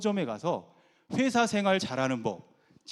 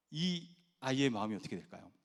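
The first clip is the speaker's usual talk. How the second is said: ordinary talk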